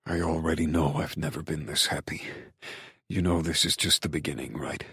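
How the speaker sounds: low, soft, intimate man's voice; tender male lover. A narrator's reading. Male lover reassures her tenderly.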